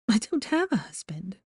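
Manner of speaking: unvoiced sharp whisper; whispered retort. A narrator's reading. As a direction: snap back in a whisper. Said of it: "she retorted".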